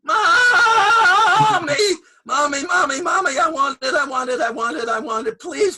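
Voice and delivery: put-on screaming, crying child tantrum; angry crying. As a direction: wail and shriek in a full tantrum. Child's tantrum at refused radio.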